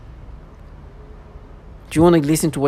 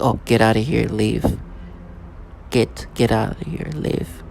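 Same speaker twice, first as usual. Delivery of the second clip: low, quieter imitation of a menacing order; a hint of a menacing voice; mock menacing voice; 4 semitones lower, 5 dB quieter than usual. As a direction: mimic the threat, dropped lower and softer. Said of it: "With this menacing voice".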